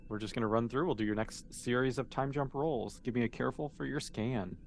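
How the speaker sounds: normal talking voice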